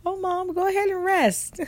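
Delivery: high, sweetly eager daughter; a lightly voiced eager daughter urging mom to rest; mock daughter voice. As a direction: play the kid happy to skip school. Voicing her daughter.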